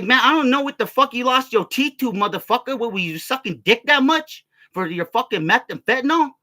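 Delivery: high, heated insulting rant; retold angry tirade. Storyteller berates toothless man, high heated retold tirade.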